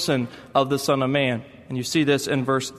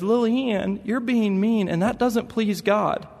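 put-on higher, slow childish reproach; a small boy lecturing his sister; little boy scolding; 6 semitones higher, slower than usual.

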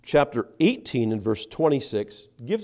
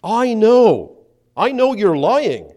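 loud, high shout, stretched at the end; very assertive accusation; 9 semitones higher, 11 dB louder, slower than usual. Acted. One of the men confronting Peter, very assertive shout. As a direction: accuse at full force, strongly and slowly. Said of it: "says very assertively, very strongly".

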